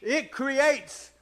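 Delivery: loud, high-pitched, driving sermon; full-force preaching. Real, not acted.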